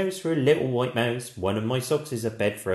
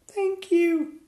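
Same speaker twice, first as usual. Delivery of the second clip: very high mouse chorus squeak; squeaky mice; 4 dB louder than usual. Acted. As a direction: squeak thanks like tiny mice. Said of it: "they squeaked".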